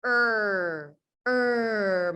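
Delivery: a drawn-out er with the tongue floating; R sound. Shown as a demonstration. Teacher modelling the R sound for learners, a long er. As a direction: growl a long er. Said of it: "R says".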